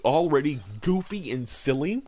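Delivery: ordinary talk, nothing marked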